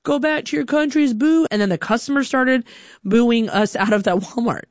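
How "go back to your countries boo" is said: boo them out of the store; hostile jeering. Retold or imitated. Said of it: "the cash register was like".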